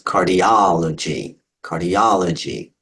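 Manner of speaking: "cardiology" slowly as car-di-OL-o-gy; slow, measured "cardiology", emphasizing OL; stress on third syllable. Shown as a demonstration. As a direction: lean on the aw sound in "cardiology". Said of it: "the aw sound that gets the emphasis".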